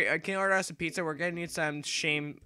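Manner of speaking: normal talking voice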